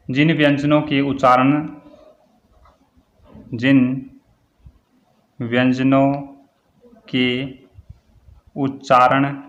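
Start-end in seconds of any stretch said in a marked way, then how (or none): 3.5-9.4 word by word with long pauses; slow dictation. Shown as a demonstration. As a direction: dictate it one word at a time for note-takers. Dictating definition for students to write.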